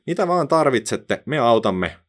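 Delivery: high, eager little bee voices; buzzing bees. A narrator's reading. Bees buzz back their eager offer of help. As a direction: answer brightly like a swarm. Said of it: "mehiläiset surisivat".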